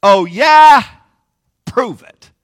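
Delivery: loud, high, mocking challenge; hammed-up defiant shout; challenging Peter. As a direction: call out a cocky dare. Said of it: "It's the part where Peter says".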